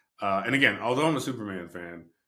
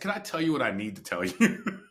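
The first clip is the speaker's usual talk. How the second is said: higher, laughing exasperated ask; laughing exasperation; 7 semitones higher, 5 dB louder than usual. Retold or imitated. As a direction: laugh at the son's doubt.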